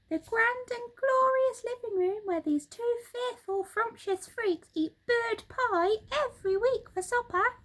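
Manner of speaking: high, bright-pitched excited monkey voice; Mugglewump the monkey. Acted. Monkey father showing birds the living room, high excited voice. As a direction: chatter it out high and quick like an eager monkey.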